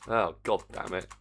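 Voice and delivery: ordinary talk, nothing marked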